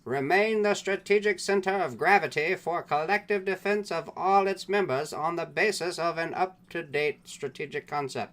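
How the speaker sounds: high, loud newsreel announcer; fifties propaganda voice. Acted. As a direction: boom it like an old propaganda reel. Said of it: "keep the fifties era, like, propaganda voice".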